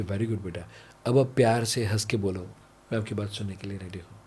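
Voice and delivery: soft praise. Retold or imitated. Parent praising calmed child. (धीमी, प्यार भरी शाबाशी)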